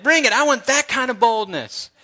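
pumped-up preacher's rallying cry; fired-up dare. Real, not acted. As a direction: throw down the challenge with high-pitched zeal. Fired up, daring persecution.

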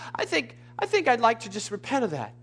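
lightly mimicked polite request; high, lighter imitation of timid repenting; mock casual repenting. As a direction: say it like a tentative, half-hearted confession. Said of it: "simply talk to it and say".

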